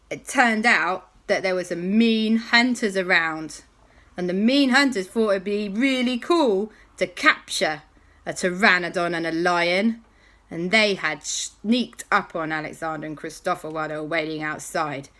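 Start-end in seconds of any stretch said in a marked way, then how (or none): none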